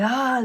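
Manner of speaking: monster attack yell. Retold or imitated. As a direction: imitate a monster lunging. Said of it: "like attacking monster".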